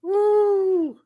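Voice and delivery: high whooping cheer; excited cheer. Real, not acted.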